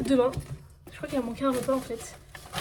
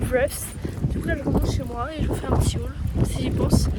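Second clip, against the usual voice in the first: wind on mic; 4 dB louder than usual. Real, not acted. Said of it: "si vous entendez le bang parce qu'il y a là du vent".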